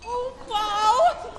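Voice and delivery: high, loud fake surprise; sarcastic gasp of wonder; mock amazement. Mocking stepmother.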